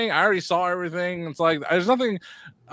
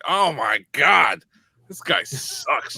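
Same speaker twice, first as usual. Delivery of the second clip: drawn-out, exaggerated exasperation; exasperated disgust; much slower than usual. Co-host recalls hearing the announcer he hates, exasperated disgust. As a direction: stretch each word in slow disgust.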